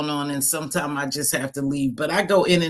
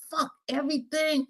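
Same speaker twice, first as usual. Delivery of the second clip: fed up; 10 semitones higher, 4 dB quieter than usual. Retold or imitated. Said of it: "And I'm like".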